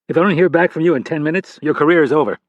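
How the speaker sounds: a senior director grilling a junior agent; stern FBI boss. Acted.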